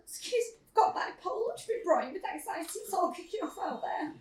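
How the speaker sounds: mock meek patient at the main desk. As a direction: ask politely, feeling small. Small polite plea at desk.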